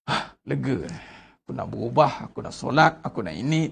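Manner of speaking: low, soft, eased-out relief; relieved sigh. Pretend. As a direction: let the burden drop from the voice. Voices a relieved repentant person.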